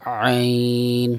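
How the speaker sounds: slow, careful Arabic ain from the throat; throat letter ain. Shown as a demonstration. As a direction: push عین out from the throat, very slow. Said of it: "اس پر محنت کرنی ہے، اس کو نکالنا ہے".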